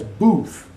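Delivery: "booth" said with a long "oo". Shown as a demonstration. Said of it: "B U is booth".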